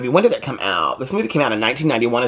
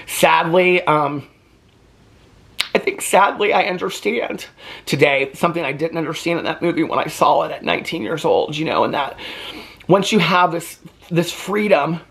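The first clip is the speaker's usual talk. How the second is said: holding back tears while talking about freedom; emotional; 6 semitones higher than usual. Real, not acted. Talking to viewers about the film, choked up and emotional. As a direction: voice rising high and thin with real emotion. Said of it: "I don't know why I'm getting so emotional".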